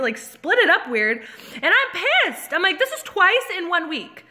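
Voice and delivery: high-pitched, indignant rant; worked up. Real, not acted. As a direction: complain with rising outrage as you retell it. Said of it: "I'm getting all worked up".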